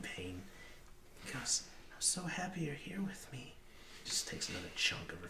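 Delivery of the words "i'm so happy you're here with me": put-on high-pitched child voice; little boy. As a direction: talk to mama in a high little boy's voice. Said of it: "human boy".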